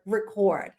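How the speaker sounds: record as ri-KORD, stress on second syllable; verb pronunciation. Shown as a demonstration. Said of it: "that's the word".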